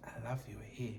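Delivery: softly whispered, no voice; whispered compliment. Retold or imitated. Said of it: "ke tlo take care of".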